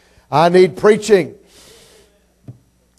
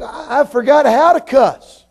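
very high, slow drawn-out wail; helpless protest; 10 semitones higher, 4 dB louder, slower than usual. Acted. Pastor admitting to a boy he can't cuss, high comic protest. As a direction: stretch it out high, in comic dismay.

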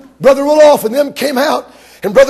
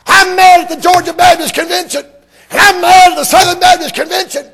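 high, loud, shouted "I'm mad"; impersonating a fiery preacher; 6 semitones higher, 4 dB louder than usual. Retold or imitated. Doctor Hudson shouting from his pulpit tape. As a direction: bellow it like an angry pulpit preacher. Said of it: "He said".

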